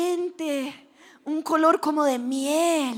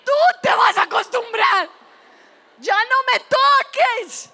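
loud, high acted yell; indignant retort; 5 semitones higher, 5 dB louder than usual. Girl refusing the waxer's touch, indignant shout. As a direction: fire it back in mock fury. Girl throws the words back angrily.

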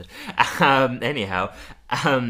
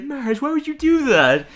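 exasperated Homer; 12 semitones higher, 5 dB louder than usual. Retold or imitated. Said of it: "And Homer's like".

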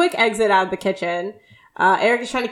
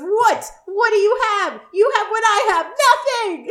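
high-pitched retold yell of outrage; full yelling; 12 semitones higher, 4 dB louder than usual. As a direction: act out a brother yelling indignantly, pitch way up. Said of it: "And [name]'s full yelling now".

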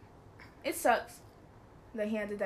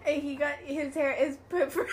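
words breaking up in laughter; laughing; 4 semitones higher than usual. Real, not acted. Laughing too hard to explain the goat to her friend. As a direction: try to talk through the giggles.

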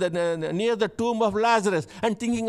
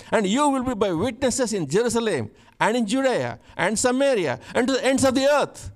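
louder, preached scripture reading; 3 semitones higher, 4 dB louder than usual. Acted. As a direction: proclaim the verses with rising force.

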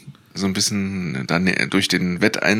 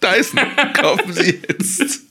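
slow, high, laughing ad pitch; chipper 1950s ad; 12 semitones higher, slower than usual. Pretend. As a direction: sell it like a cheesy commercial. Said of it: "Wir müssen wirklich Werbung machen".

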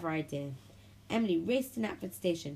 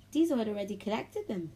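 ordinary delivery, nothing marked

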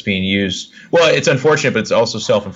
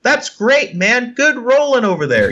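much higher, fake-cheery praise; mock congratulations; 13 semitones higher, slower than usual. Player mocking his own fake praise to opponent, high sarcastic voice. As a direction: congratulate him through clenched politeness. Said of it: "And you're like".

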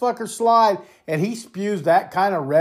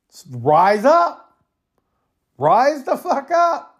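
slow, drawn-out call to rise, climbing higher; rallying cry; 5 semitones higher, slower than usual. Real, not acted. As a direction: stretch each word out as a rising exhortation. Rallying citizens to revolt.

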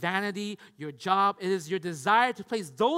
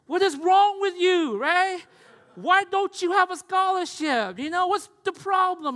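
hammed-up scolding, high and loud; loud, high, acted parental demand; exasperated parent; 10 semitones higher, 9 dB louder than usual. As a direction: scold your child in mock outrage, drawn out. Acted parent scolding own child.

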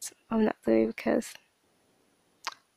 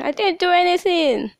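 raised, louder pleading through tears; retold crying plea; 8 semitones higher, 5 dB louder than usual. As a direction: plead your innocence, half in tears. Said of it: "and I was crying".

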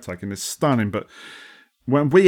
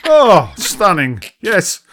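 sarcastic admiring; 4 semitones higher than usual. Acted. Mock viewer admiring Glasgow.

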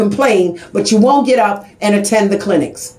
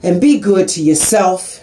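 tender blessing; 7 semitones lower than usual. Real, not acted. Host blessing her listeners at the end, tender and low. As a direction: bless them gently as you say goodbye.

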